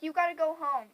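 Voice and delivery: loud send-off; firm order. Retold or imitated. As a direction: order him out loudly. Sister sending boy away, retold.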